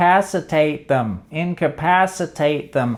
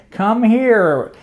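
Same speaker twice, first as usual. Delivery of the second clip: higher summoning call; 6 semitones higher than usual. Acted. Owner summoning a phone from the couch, calling out. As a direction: call it over like a pet.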